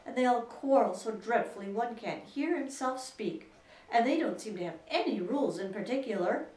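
aggrieved, worked-up grumbling; rather complaining. A narrator's reading. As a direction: protest indignantly with lively pitch swings. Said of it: "in a rather complaining tone".

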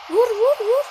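high, loud urgent shouts; urgent shouting. Real, not acted. Gamer to on-screen player to shoot, urgent shouting. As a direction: yell for the shot right now. Urging a shot in game.

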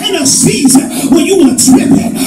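loud, fast, rising preacher cadence; hammering sermon refrain; full-force preaching. Acted. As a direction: drive it home to the congregation. Preaching builds again.

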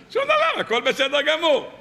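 mocking high exclaim of nothing; preacher mocking listeners, high sarcastic exclaim. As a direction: scoff at indifference to a miracle. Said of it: "mocking nothing changes".

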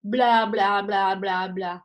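loose floppy blahs, sinking and fading. Shown as a demonstration. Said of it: "just go for me".

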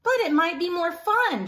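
coax, bright and lifted; hopeful Trevor. Acted. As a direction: Trevor asks Pig to play, lifted. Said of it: "Trevor said to Pig".

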